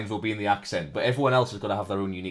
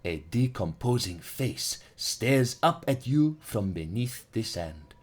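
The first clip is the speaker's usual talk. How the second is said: ordinary talk, nothing marked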